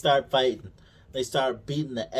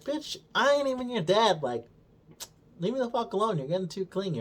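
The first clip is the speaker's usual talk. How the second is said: mocking brush-off; 8 semitones higher, faster than usual. Voicing Obi-Wan brushing her off.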